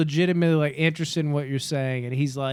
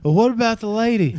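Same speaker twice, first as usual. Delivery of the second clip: mock Saul; 7 semitones higher, 6 dB louder than usual. Quoting Saul's question about massacre.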